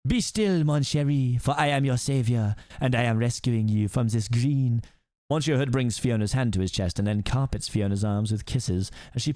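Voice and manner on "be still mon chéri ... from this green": put-on hammy French accent, slowing at the end; generic French accent. French rescuer soothing the princess, generic French accent. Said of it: "that's my very generic French accent".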